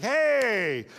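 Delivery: long, high, loud "hey"; mock casual greeting. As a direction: sing out a hammed-up hello. Disguised Saul greets the witch.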